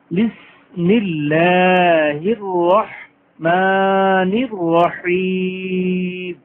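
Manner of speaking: very slow drawn-out chant; Quran recitation. Shown as a demonstration. Teacher reciting basmala for the class, slow tajweed chant. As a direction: stretch every vowel like a reciter. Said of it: "teacher recites basmala".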